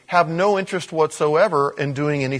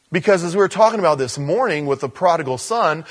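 ordinary delivery

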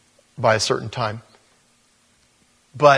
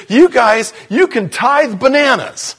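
emphatic retort; 11 semitones higher, 8 dB louder, slower than usual. Retold or imitated. Preacher's punchline retort.

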